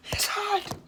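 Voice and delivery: sudden high-pitched squeak. Real, not acted.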